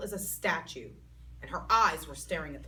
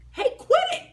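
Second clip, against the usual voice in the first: very high, loud yell; 9 dB louder than usual. A narrator's reading. Junie yelling at May, very high and loud. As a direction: yell it at her. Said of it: "I yelled".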